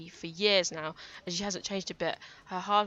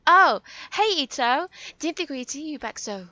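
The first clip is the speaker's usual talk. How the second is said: much higher, louder chatty coworker voice; gossipy Saki; 8 semitones higher, 11 dB louder than usual. Acted. Coworker Saki greeting Ito back at work, high gossipy voice. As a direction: gush like the office gossip. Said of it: "she's gossipy".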